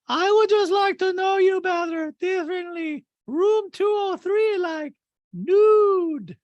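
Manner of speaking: high, loud put-on Diana voice; Diana impression. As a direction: chirp it up high like a prissy socialite. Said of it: "And Diana goes".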